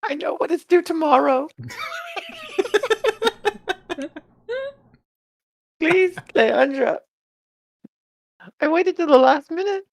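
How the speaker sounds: put-on high, sweet little girl voice. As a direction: play an eager schoolgirl asking a favour. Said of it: "make myself look like a little girl".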